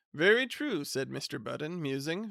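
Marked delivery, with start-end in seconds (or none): 0.1-0.8 lightly voiced hearty husband; a touch of smug cheer, raised at the start; Mr Budden's voice. Husband announcing his plan to his wife, lightly voiced.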